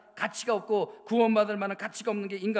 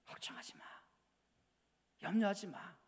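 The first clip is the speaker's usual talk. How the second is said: hushed, near-whispered reassurance; whispered comfort; 10 dB quieter than usual. Acted. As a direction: breathe it softly to comfort. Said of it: "하나님의 사랑".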